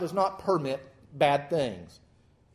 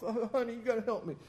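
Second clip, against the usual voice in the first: mock whimper; 5 semitones higher, 7 dB quieter, faster than usual. Whining sick husband to wife.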